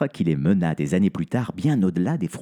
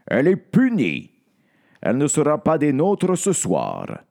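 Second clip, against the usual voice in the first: higher, louder stern count; 6 semitones higher, 3 dB louder than usual. Acted. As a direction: answer firmly as the vampire count. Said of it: "répond le comte".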